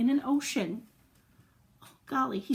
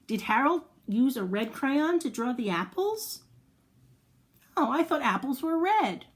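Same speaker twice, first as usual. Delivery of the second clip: usual speaking voice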